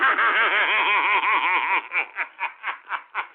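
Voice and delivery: mocking belly laugh; gloating laughter; gleeful laugh. Real, not acted. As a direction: laugh at their defeat. Gloating after taunting.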